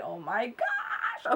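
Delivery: very high, slow, breathy oh my gosh; breathless squeal. Real, not acted. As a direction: squeak it out in awe. Opening the long-awaited palette.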